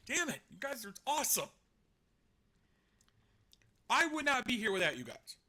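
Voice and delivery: usual speaking voice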